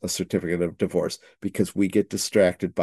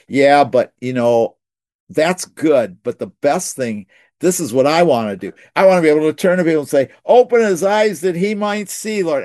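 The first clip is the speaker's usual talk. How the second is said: high, loud, fast eager burst; excited retelling; 7 semitones higher, 5 dB louder than usual. Retold or imitated. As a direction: push it out with rising excitement. Pastor excitedly retells his wish.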